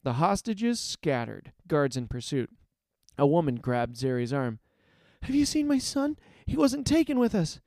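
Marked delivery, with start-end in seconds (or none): 5.2-7.6 high, anxious pleading; worried mother. A narrator's reading. Mother grabs rescuer's arm asking about son, worried.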